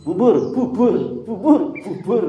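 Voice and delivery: a mock Javanese "pubur, pubur"; Javanese bubur. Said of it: "tetap aja dia ngomong bubur".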